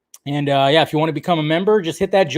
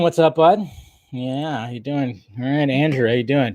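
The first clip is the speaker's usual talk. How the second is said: normal talking voice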